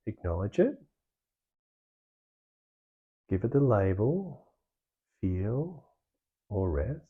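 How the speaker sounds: calm meditation guide with long pauses; guided meditation. Real, not acted. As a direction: ease them through it, leaving space between phrases. Leads the meditation practice.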